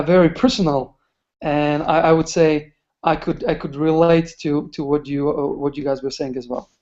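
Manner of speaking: usual speaking voice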